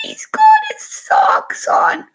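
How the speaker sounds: high, loud squealing delight; excited squeal. Retold or imitated. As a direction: squeal with joy at the baby. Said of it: "I said".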